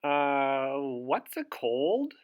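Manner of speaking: a touch of a confused little man asking; puzzled Mister Sneeze. Acted. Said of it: "asked Mister Sneeze".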